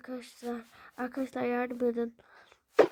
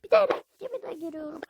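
panicked high cry; 11 semitones higher, 5 dB louder than usual. Real, not acted. Panicked exclamation during gameplay.

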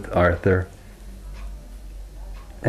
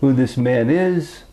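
newcomer voice; 9 semitones higher than usual. Acted.

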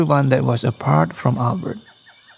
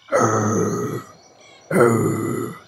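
low, drawn-out bear rumble; bear growl; 4 semitones lower than usual. A narrator's reading. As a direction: growl like a big grizzly. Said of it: "the great big grizzly bear".

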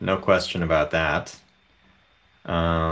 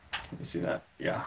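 much softer, whispered question; whispered aside; 4 semitones lower, 13 dB quieter, faster than usual. Real, not acted. Owner whispering to viewers while holding her dog, hushed. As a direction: drop to a whisper, as if not to disturb.

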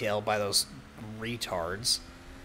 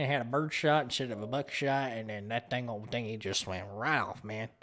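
put-on southern accent. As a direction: ham up a country Southern accent. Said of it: "See, I'm decent at it".

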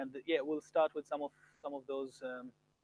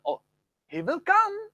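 much higher, louder astonished tone; surprised; 13 semitones higher, 7 dB louder, slower than usual. Shown as a demonstration. Teacher acts surprised Trump is coming, much higher. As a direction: gasp it out in surprise. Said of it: "When you're surprised".

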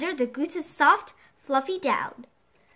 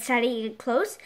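plain reading voice

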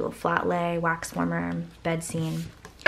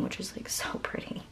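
whisper; 13 dB quieter than usual. Real, not acted. Gushing over mint green paper.

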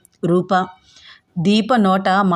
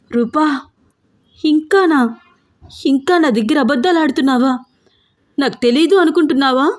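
angry shouting; 6 semitones higher than usual. Acted. Older sister catching her sister lying, angry shouting. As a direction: snap at her for still lying. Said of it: "కోపంగా అరుస్తూ అంది దీప".

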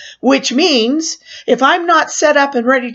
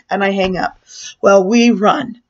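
normal talking voice

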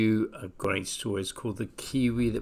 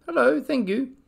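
high, shy little voice; small timid thank-you; little kiwi; 5 dB louder than usual. Acted. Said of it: "The little Kiwi said".

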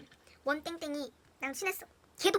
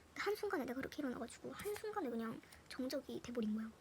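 normal talking voice

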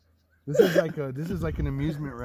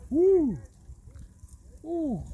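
startled whoops; 6 dB louder than usual. Real, not acted. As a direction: yelp at each jolt of the lift. Rider reacting to chairlift jolts.

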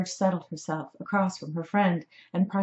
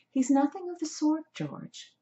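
high voice, dropping on the name; airy, high-pitched correction; indignant young woman; 10 semitones higher than usual. A narrator's reading. Rescuer defending the cat to George, indignant young woman. Rescuer rebukes her horrified friend.

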